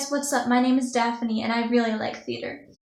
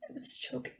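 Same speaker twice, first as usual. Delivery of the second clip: voiceless, much softer whisper; choked whisper; 10 dB quieter than usual. Acted. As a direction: let the voice drop away to a whisper. Monologue recalls choking in panic.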